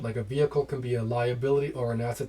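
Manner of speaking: ordinary delivery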